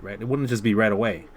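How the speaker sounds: normal talking voice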